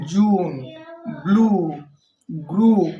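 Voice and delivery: teacher modelling the long "উ" in "জুন", "ব্লু", "গ্রুপ"; clear example words. Shown as a demonstration. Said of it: "যেমন".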